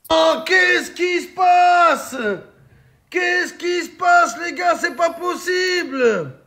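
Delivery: high, slow, disbelieving cry; shocked shout. Real, not acted. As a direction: yell it out in disbelief. Stunned reaction to a goal.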